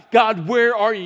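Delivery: loud desperate call; crying out to God. Retold or imitated. Estranged parent screaming to God, loud plea. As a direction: plead with God aloud. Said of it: "you're just screaming to God".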